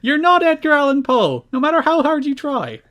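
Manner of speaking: high, loud mocking plea; squeaky scolding voice; mock exasperated friend. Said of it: "I was like".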